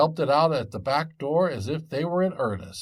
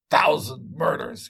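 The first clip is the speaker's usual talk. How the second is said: pained cry; 4 semitones higher, 4 dB louder than usual. Acted. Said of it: "cried he".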